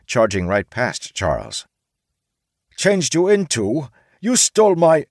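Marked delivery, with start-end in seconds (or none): 2.8-5.1 samurai caught out, trailing off; flustered samurai. A narrator's reading. Samurai argues back.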